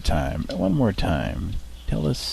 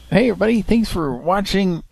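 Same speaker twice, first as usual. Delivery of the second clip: cheerful sign-off; 12 semitones higher, 5 dB louder than usual. Real, not acted. Stream sign-off greeting.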